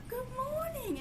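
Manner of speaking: high, sing-song answer for the dog; dog's voice. Retold or imitated. Voicing her dog saying good morning back, high and sing-song. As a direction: voice the dog's sleepy reply up high. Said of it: "And she's like".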